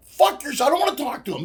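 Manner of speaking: bursting out fed up; annoyed outburst. Real, not acted. Telling co-host about pushy friends, exasperated outburst.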